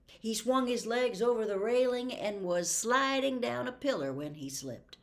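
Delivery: ordinary talk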